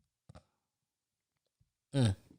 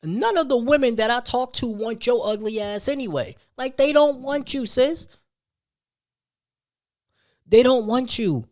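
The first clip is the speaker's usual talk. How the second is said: high, loud, taunting jab; heated taunt; 9 semitones higher, 4 dB louder than usual. Real, not acted. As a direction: rub it in hard and loud.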